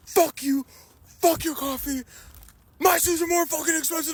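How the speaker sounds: high, punchy imitation of cursing; mock angry retort. Steve curses back at Ben, in a high mock-angry voice. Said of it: "Ben starts yelling".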